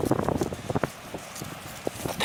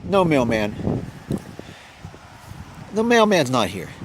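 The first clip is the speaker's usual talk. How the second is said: calm reassuring; 7 semitones lower than usual. Real, not acted. Calming dog after tease.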